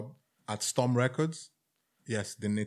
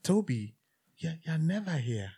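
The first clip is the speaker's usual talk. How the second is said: put-on mild South African accent, pitched up high; 6 semitones higher, 4 dB quieter than usual. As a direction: imitate the landlady in a South African accent, rising higher toward the end. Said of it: "she was a South African woman".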